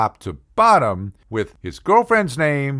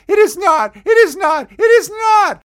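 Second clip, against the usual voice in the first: much higher, louder shrieking denial; indignant shriek; 7 dB louder than usual. Retold or imitated. Girlfriend denies her lopsided eyes, shrieking loud and high. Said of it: "she all of a sudden goes".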